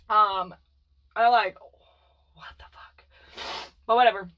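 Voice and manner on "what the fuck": hushed, breathless under-the-breath remark; whispered aside. Real, not acted. Remembering hiding in the closet, whispered aside. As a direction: drop to a whisper as if still hiding.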